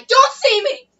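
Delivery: loud, high outburst; sudden yell. Real, not acted. Girl cutting into friends' chat, sudden yell.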